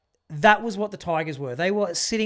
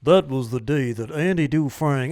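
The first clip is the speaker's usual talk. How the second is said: lower-pitched movie narrator impression; amateur impression of a film narrator; Morgan Freeman; 4 semitones lower, 3 dB louder than usual. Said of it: "Morgan Freeman's like".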